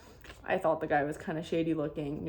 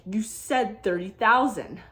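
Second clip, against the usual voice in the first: louder, higher and slow, stressing each word; angry retold protest; 4 semitones higher, 7 dB louder, slower than usual. Snapping back at the cheating umbrella seller, angry and loud. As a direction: press the point, getting mad. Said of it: "I started getting so mad".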